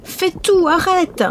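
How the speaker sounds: high, rising panic at the pot; panicked cry. A narrator's reading. Mother panics.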